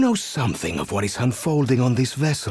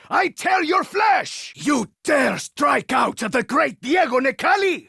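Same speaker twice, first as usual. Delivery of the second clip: furious taunt; 11 semitones higher than usual. Acted. Diego answers an enemy who tore his coat with a furious roar. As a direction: bellow it back at the enemy.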